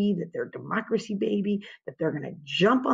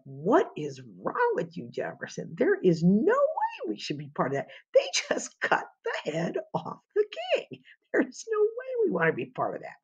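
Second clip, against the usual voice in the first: much higher, exasperated near-cry; Hamilton freaking out; 10 semitones higher than usual. Retold or imitated. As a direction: play Hamilton losing it at Jefferson. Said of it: "is freaking out".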